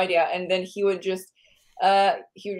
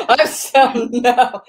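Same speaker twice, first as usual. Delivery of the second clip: helpless laugh breaking into words, higher and louder; 13 semitones higher, 9 dB louder, much slower than usual. Real, not acted. Speaker cracks up at their own list of ancestries, laughing. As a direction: talk through a real laughing fit.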